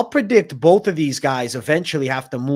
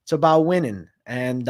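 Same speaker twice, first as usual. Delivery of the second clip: usual speaking voice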